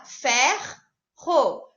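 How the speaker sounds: "Ferro" broken as "fer", pause, "ro"; split into syllables. Shown as a demonstration. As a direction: dictate "Ferro" in two slow pieces, both R's sounded. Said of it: "como é que se separa?".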